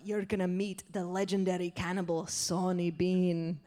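low build-up, slowing on the name; low, theatrical horror-host voice; ominous butcher. Acted. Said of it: "the butcher's like".

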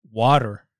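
Water as wah-der, r sounded; American pronunciation. Retold or imitated. Imitating those who correct Australians, Water as wah-der. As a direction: open the vowel and end Water on an r. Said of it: "They're the only ones that say it like that".

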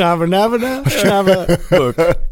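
high, slow mock Chinese gibberish; hammed-up fake Chinese babble; fake Chinese accent. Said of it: "That was the worst Chinese accent".

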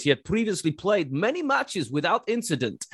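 put-on a light, half-hearted Russian accent. Telling a co-host the organiser's words, lightly in a Russian accent. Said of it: "I'll do it in a Russian voice".

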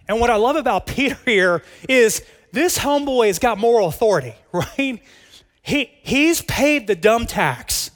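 amused laughing delivery. Real, not acted. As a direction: chuckle while praising Peter. Laughing about Peter.

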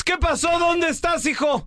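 high, scolding wife voice, half-joking; angry wife voice. Retold or imitated. Wife scolds her late husband on the phone, mock angry and high. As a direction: play the angry wife demanding where he is. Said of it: "Y la Pau viene enojada".

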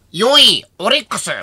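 loud, high draft announcer; Pancho Ito. Retold or imitated. Announcer calling out a draft pick, imitated proclamation. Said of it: "パンチョ伊藤さんが、叫んだ".

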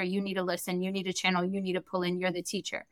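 a hushed spirit voice giving instructions; quiet spirit voice. Retold or imitated. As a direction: speak softly as a distant spirit. Spirit voice quoted to her.

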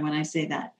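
ordinary talk

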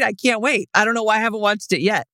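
normal talking voice

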